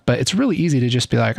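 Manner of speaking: usual speaking voice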